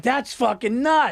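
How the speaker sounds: exaggerated wowed reaction; mock amazement. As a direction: stretch it out in mock awe. Mocking their own delighted reaction.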